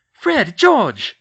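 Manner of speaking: loud, high mother's scold. Acted. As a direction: scold the twins like an alarmed mum. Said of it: "Missus Weasley scolded".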